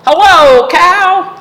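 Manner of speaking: high, loud cheery duck hello; Duck's greeting. A narrator's reading. Storyteller voicing Duck greeting Cow, high and loud. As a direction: call out brightly as Duck.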